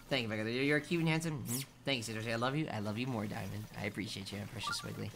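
gentle affectionate thanks; tender thanks. Real, not acted. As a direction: coo gratitude to a friend. Thanks chat members tenderly.

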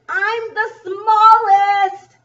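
very high, loud, sing-song squeal; stretched-out, piping storybook climax; smallest bug. Acted. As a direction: crow it slowly as the tiniest bug.

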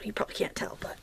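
soft unvoiced aside to the viewers; half-secret whisper mid-demo. Real, not acted. Crafter whispering an aside to viewers while pressing hard.

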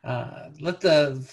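ordinary delivery

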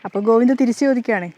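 ordinary delivery

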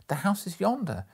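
exclaiming. A narrator's reading. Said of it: "Scrooge exclaimed".

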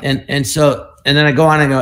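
ordinary talk

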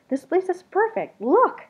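high, loud and eager; bursting with delight at the place; excited creature. A narrator's reading. Creature insists to Something Else, high and thrilled.

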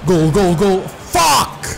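excited goal shout. Real, not acted. Goal then disappointment.

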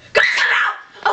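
harsh, drawn-out roar without clear pitch; scary yell. Acted. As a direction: roar it at them to scare them out. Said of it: "She scares me".

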